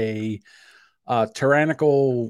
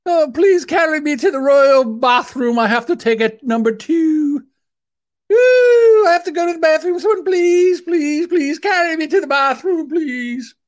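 very high, pleading royal falsetto; hammed-up Queen; 13 semitones higher, 5 dB louder than usual. Helpless Queen calling servants for the bathroom, in hammed-up falsetto. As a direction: beg for help as a mock British Queen. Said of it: "For the Queen, light duties".

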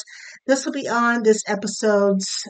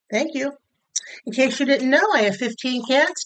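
ordinary delivery

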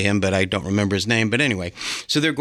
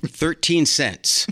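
usual speaking voice

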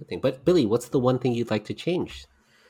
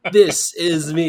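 1 mock wrestler boast; 6 semitones higher, 7 dB louder, much slower than usual. Repeated wrestler quote louder.

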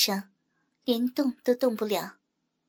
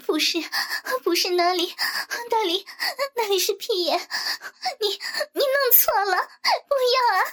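panicked high protest climbing to a yell; gasping, high-pitched distressed pleading; frantic cry; 9 semitones higher than usual. Acted. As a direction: stammer in alarm, building to a desperate shout. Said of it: "梦洁疯狂地大叫道".

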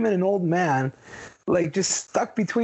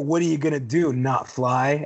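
low-pitched, couldn't-care-less retort; dismissive attitude; 7 semitones lower than usual. Retold or imitated. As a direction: shrug off the complaint, pitched down. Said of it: "attitude about it".

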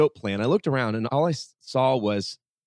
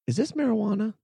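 curious janitor; 10 semitones higher than usual. Retold or imitated. Janitor asks about the tea.

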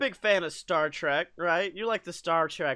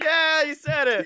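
high, raised-voice whoop of approval; mock audience cheer; 7 semitones higher, 4 dB louder than usual. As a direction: cheer like a studio audience at applause time. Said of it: "the applause would happen. We'd be like".

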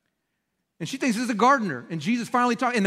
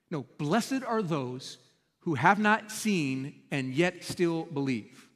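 very slow, much softer, intimate blessing; solemn and tender; 3 semitones lower, 5 dB quieter, much slower than usual. Acted. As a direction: let it land gently, almost hushed. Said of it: "preacher's solemn close of rebuke".

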